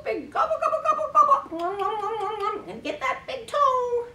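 high, playful munching noises; pretend gobbling. Carer pretending to eat baby's toe, playful gobbling. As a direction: gobble it up greedily in play. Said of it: "we're gonna pretend to gobble".

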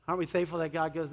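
usual speaking voice

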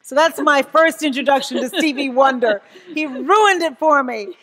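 loud, laughing complaint; high amused grumble. Real, not acted. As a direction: laugh through the punchline, slowing down. Laughing retelling of first meeting.

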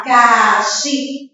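"Abacaxi" slowly, in four drawn-out syllables; syllables sounded out. Shown as a demonstration. Teacher showing how she speaks, "Abacaxi" syllable by syllable. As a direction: stretch and separate each syllable of "Abacaxi". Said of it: "Olha como eu falo".

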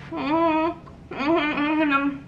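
soft, high closed-mouth moans; pained whimpering. Real, not acted. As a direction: whimper through closed lips from the burn. Suffering from the hot sauce.

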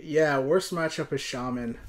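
ordinary talk, nothing marked

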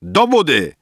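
high, loud, contemptuous command; shouted insult. A narrator's reading. Rafael ordering cursing guests away, loud high shout. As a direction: shout them back like dogs. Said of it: "wykrzyknął Rafael".

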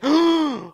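sharp, loud, high gasp; startled gasp. Real, not acted. Gamer spots a strange creature, startled gasp. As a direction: gasp in sudden alarm.

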